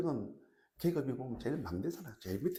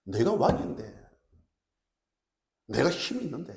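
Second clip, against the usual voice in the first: loud, indignant royal protest; angry king; 3 semitones higher, 6 dB louder than usual. Acted. Preacher voices King Nebuchadnezzar insisting on his power, angrily. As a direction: pull rank with offended force. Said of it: "느부갓네살 왕이 분노하는 이유가 뭡니까".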